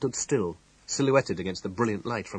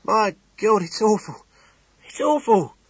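high, loud, distraught cry; a shaken little man who can barely get it out; horrified innkeeper; 13 semitones higher, 9 dB louder than usual. Acted. Innkeeper tells Temple the awful news, high and distraught. Shocked innkeeper reports a death.

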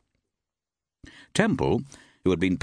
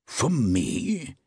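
higher, mildly surprised query; some surprise; 6 semitones higher than usual. Acted. Forbes puzzled about the letter, mild surprise. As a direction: caught off guard, a touch surprised. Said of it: "queried Forbes in some surprise".

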